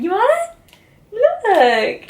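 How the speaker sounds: very high sing-song voice for the dog; high puppy-talk coo. Real, not acted. Offering toy to dog.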